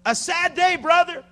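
high, loud, drawn-out lament; mourners' cry. Acted. Preacher voicing the mourning people of scripture, loud lament. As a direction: cry it out slowly, rising on the last word.

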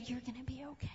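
soft whisper of reassurance; whispered comfort. Acted. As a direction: whisper it like calming a child. God comforting his children.

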